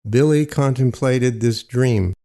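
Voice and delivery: ordinary delivery, nothing marked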